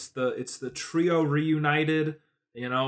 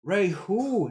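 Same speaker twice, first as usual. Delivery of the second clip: mock passerby; 8 semitones higher than usual. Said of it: "it's like".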